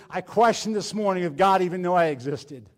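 ordinary talk, nothing marked

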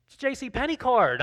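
a retold indignant insistence, pitch jumping up. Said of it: "I'm kinda getting a little indignant now".

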